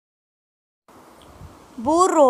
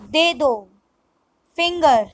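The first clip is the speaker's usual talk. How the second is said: normal talking voice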